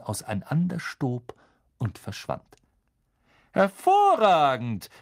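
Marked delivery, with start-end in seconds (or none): 3.5-4.9 loud, drawn-out, high praise; Lupin. A narrator's reading. Teacher praising Neville's victory, excited call. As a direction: cry it out slowly in delight. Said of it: "rief Professor Lupin".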